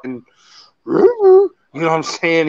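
high wordless cartoon-dog noise; Scooby-Doo impression. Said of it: "the guy that voices Scooby-Doo's walking around".

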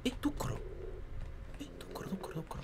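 息だけのささやき声; 聞かれないよう息で囁く; ささやき. Real, not acted. 声を抑えて囁く. (息だけでささやく)

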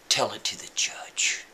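low whispered brush-off; cold whisper. Retold or imitated. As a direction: hiss it dismissively under the breath. Cop whispers reply.

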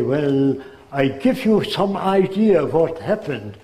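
put-on a German immigrant's careful English in a German accent. Old man imitating German immigrants, light German accent. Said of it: "That's the way they speak".

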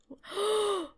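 sharp high intake of breath; startled gasp. Real, not acted. Player discovering the storage room, frightened gasp.

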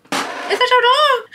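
high, loud, drawn-out squeal; exaggerated delighted shriek; gushing stranger. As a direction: imitate an excited woman cooing over a dog. Acts out delighted sister in store.

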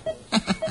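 bitter short laugh. Acted. Prisoner laughs at himself.

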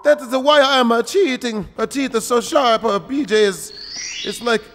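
put-on Italian-plumber voice; hammed-up cartoon plumber; Super Mario voice. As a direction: complain in a mock Mario accent. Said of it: "Super Mario voice".

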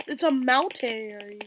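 slow drawl. Pretend. Slow drawn-out comment.

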